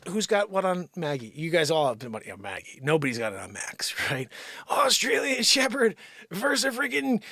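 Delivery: normal talking voice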